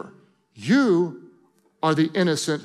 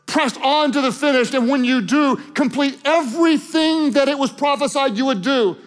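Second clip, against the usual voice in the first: loud, high impassioned preaching; fervent pulpit exhortation; preaching at full force; 10 semitones higher, 4 dB louder than usual. Acted. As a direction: drive it home like a revival preacher.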